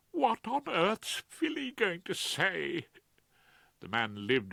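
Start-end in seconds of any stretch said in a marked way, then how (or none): 0.1-2.9 high, fretful Claude voice; Claude, anxious. Acted. Claude frets to Rumpole, high and anxious. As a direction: voice a nervous barrister pleading.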